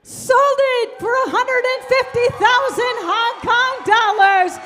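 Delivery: loud triumphant sold call; excited shout. Real, not acted. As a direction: shout it out to the room. Auctioneer announces sale, shouting.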